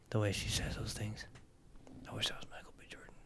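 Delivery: breathless whisper. Real, not acted. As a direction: whisper it like a secret crush. Dreamy confession about the scene.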